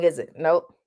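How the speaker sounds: usual speaking voice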